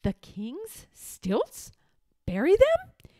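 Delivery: soft questions leaping up high at the end; bewildered. A narrator's reading. Page boy puzzled by the order, bewildered. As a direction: query it in boyish disbelief. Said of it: "Eric didn't know what to think".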